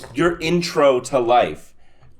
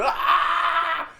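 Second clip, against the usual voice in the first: high startled yelp, hammed-up; a sudden jolting yelp of coming to; mock yell; 13 semitones higher, 4 dB quieter than usual. Friend rolling over after the fall, high mock yelp.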